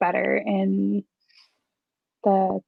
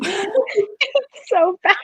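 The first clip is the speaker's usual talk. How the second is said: laughing groan; 9 semitones higher, much slower than usual. Real, not acted. Group groans over bad kiss scene.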